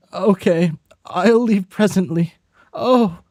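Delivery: the voice meant for another character; wrong character voice. Acted. A friend agreeing to come pick them up, in the wrong high voice. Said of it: "I'm doing the wrong voice".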